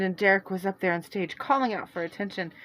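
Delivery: ordinary delivery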